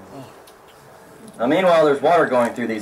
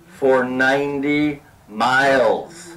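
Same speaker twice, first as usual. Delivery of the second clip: slow emphasis; much slower than usual. Acted. Emphasizing the ditch length.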